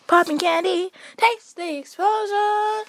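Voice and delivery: announcer voice. Pretend. Reading package slogan dramatically.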